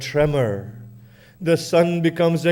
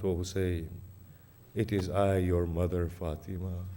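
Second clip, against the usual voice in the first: soft, low mournful mother's call; crying voice; 7 semitones lower, 9 dB quieter than usual. Acted. Fatima calling gently to her dying son, soft sorrow. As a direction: call softly as a grieving mother. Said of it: "a crying voice calls out".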